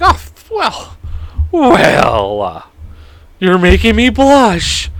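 high, slowing bashful coo to an insult; mock flattered. Answering insults with a mock bashful coo. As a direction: coyly pretend the insult is a compliment, drawn out. Mock reply to being called idiot.

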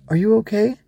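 put-on high, rising child's question; worried child. Ava pokes the fallen Growbug, worried. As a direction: ask anxiously in a little girl's voice.

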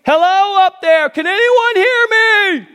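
very high, loud desperate call upward; panicked shout from a man dangling on a branch; scream for help. Acted. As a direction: yell up the cliff for help. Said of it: "He began to scream".

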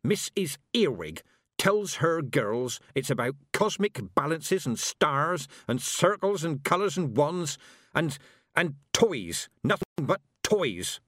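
growled scorn. A narrator's reading. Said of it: "her voice sinking to a growl".

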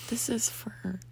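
hushed whisper, as if not to be overheard. Real, not acted. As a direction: whisper it quickly under your breath. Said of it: "this is supposed to be appropriate".

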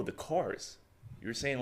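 usual speaking voice

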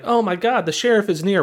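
hammed-up alarm; mock excited. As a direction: play the writers jumping at an idea.

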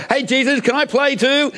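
very high-pitched, playful call; eager, bold Peter. Acted. Peter stepping out of the boat to Jesus, eager high-pitched call. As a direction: call out like a keen kid wanting to join in. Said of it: "courageously and started walking on the water".

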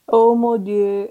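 slow, drawn-out French sigh of words; mock French accent. Co-host playing at speaking French, drawn-out French accent. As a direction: stretch the French vowels. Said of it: "ξεκινάμε να μιλάμε γαλλικά".

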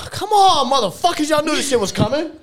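high, loud exasperated call-out; exasperated yell. Real, not acted. Host yelling at guests who dodge the sign-off, loud exasperated. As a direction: shout at them to get on with it.

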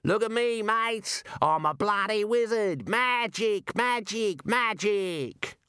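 high, jeering singsong call; mocking show-off. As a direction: crow to your mates, pretending to do spells. Raider mocks wizardry to riders.

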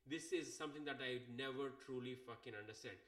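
ordinary talk

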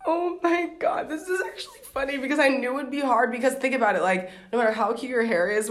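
bursting with amusement; excited laughing. Real, not acted. Laughs at friend's reaction.

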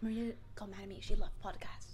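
soft, voiceless whisper; whispered prank line. Pretend. Plotting a prank call with her friend, whispered.

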